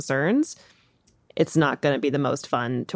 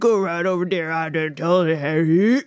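garbled, drawn-out backwoods trapper impression; slurred, slow character voice, barely intelligible; Tom Hardy. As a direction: mumble it like an unintelligible movie frontiersman. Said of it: "this is my impression ... of Tom Hardy in The Revenant".

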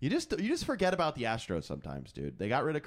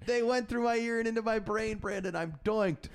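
mock whine; 11 semitones higher than usual. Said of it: "I'm falling apart".

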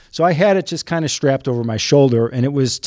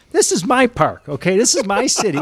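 raised pitch, comic territorial outburst; indignant South Sider; 6 semitones higher than usual. Acted. South Sider griping about outsiders in his park, mock indignant. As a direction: stake your claim like a riled-up local. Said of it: "this is where the South Sider in me comes out".

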